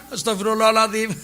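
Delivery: plea bursting into a fake guffaw; mock laughing istighfar. Preacher parodies crazy person laughing while repenting, mock laughing istighfar. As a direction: recite it, then laugh like a madman. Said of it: "apa ketawa kan".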